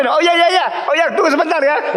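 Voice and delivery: high, quick put-on voice; host answering a knock, high and fast; eager homeowner. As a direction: chirp it out like a host at the door. Voicing someone answering the door.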